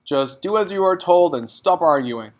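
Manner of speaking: snapping father. Acted. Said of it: "the father snapped".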